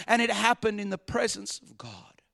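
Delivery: hushed. Real, not acted. Sermon drops to hush.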